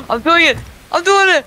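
high, loud excited shout; excited yell. Real, not acted. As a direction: shout it in thrilled disbelief. Climbing rock, yelling excitedly.

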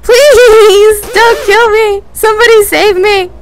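high, loud desperate begging; terrified pleading. Real, not acted. Player begging Mister Dragon not to kill him. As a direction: scream for mercy, terrified. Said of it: "I'm so scared!".

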